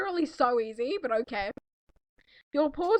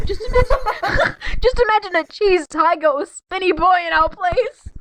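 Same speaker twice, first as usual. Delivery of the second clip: higher, louder through laughter; laughing exclamation; 5 semitones higher, 7 dB louder than usual. Real, not acted. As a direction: crack up imagining it.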